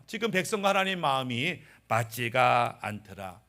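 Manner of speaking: normal talking voice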